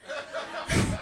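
soft unvoiced laugh; breathy giggle. Real, not acted. Laugh after picking her nose.

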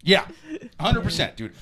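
ordinary delivery

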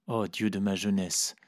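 ordinary delivery

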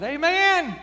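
sudden high, loud shout of joy; shouted amen. Retold or imitated. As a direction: burst out from the pew at full voice. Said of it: "that old boy shouted".

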